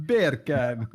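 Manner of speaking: laughing attempt at a Jamaican accent; amateur Jamaican accent, on the edge of laughter; bad Jamaican accent. Shown as a demonstration. Repeating the beer can joke for friends, bad Jamaican accent. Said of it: "absolute worst Jamaican accent".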